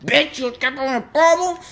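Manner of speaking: high-pitched indignant yell; angry taunt. Real, not acted. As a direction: throw it at her, voice jumping way up. Confronting the fleeing woman in game.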